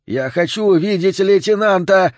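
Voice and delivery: high, loud squealing vagrant; визгливый крик. A narrator's reading. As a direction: shriek your demand at the cops. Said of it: "визжал бездомный". (визгливый крик бродяги)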